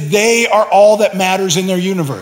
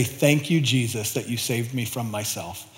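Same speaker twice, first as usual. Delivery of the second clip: grateful prayer; 6 semitones lower, 10 dB quieter than usual. Retold or imitated. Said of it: "being able to say".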